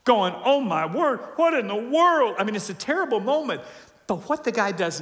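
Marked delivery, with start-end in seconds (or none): 0.4-2.4 high, loud cry of alarm; hammed-up anguished shock; acted agony. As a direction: cry out as if waking in torment. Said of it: "he wakes up in Hades in agony".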